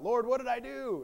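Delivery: high, loud mock plea to heaven; crying out. Job pleads with the Lord over his troubles, crying out. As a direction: cry out as an aggrieved Job. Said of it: "then Job cries out".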